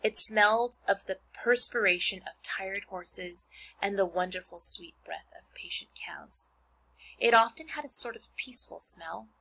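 put-on expressive read-aloud to children; reading a book to a class; story narration. Reads Charlotte's Web aloud.

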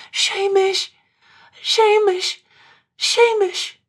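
high, loud cries building each time; desperate scream. Acted. Katrine screaming for her dead lover, high and desperate. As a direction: scream the name with her last strength. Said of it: "she screamed the name of the dead man".

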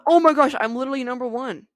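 high, loud thrilled exclamation; excited. Real, not acted. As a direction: burst out with joy. Reaching first place.

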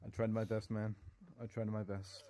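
low, subdued admission of failure; resigned defeat. Pretend. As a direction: say it quietly, like a beaten lawyer. Lawyer gives up after lost case.

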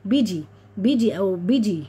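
"بيجي" with ee in the second syllable; wrong vowel. Shown as a demonstration. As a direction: say "بيجي" short, as a mistake. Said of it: "محدش بقى يقول لي هنا".

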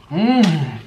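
normal talking voice

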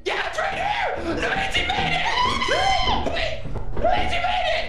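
high excited shouting; excited shriek. Real, not acted. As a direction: squeal with high glee. Shrieking Luigi escapes.

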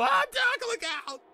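very high panicked shriek; screamed warning. Acted. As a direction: scream to warn the driver, voice shooting up. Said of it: "Morty scream a warning".

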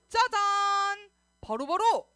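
loud, high, long-held fanfare; ta-da reveal. Pretend. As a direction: unveil a surprise with a big flourish. Teacher reveals a prop to kids. (신나게 길게 외치기)

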